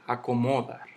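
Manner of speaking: "acomodar" stressed on MO; misplaced stress. Shown as a demonstration. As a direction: lean on the MO of "acomodar" as a wrong example. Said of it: "Yo no digo".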